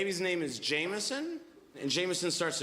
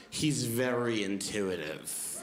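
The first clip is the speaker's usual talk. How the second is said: passive-aggressive; 7 semitones lower, slower than usual. Retold or imitated. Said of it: "she goes".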